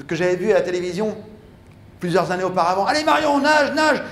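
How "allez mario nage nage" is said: much higher, louder cheering; cheering shout. Retold or imitated. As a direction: scream encouragement at a swimmer. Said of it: "son équipe qui l'encourage".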